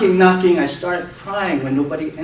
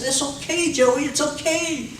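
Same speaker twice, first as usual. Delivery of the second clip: put-on high, gentle hushing of a baby; soothing baby; 10 semitones higher than usual. Parent soothing a screaming baby.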